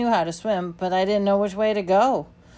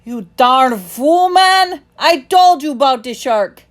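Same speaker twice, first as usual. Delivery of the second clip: loud, high, slow furious scolding; angry yell; 6 semitones higher, 11 dB louder, slower than usual. A narrator's reading. Timothy scolding the boy who fell overboard, angry yell. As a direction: bellow at him for his folly. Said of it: "He yelled".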